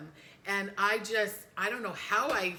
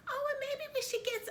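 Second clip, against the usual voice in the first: much higher, light and breezy; chirpy, unbothered by the crisis; airy mom. Retold or imitated. Mom blithely planning shopping, much higher and airy. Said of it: "and Mom was just like".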